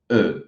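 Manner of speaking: "E" with rounded lips, O-shaped mouth; rounded French vowel. Shown as a demonstration. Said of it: "é uma boca de O fazendo o som E".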